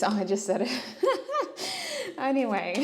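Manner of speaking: real laughing at your own slip; amused laughter. Real, not acted. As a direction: break into giggles as you catch yourself.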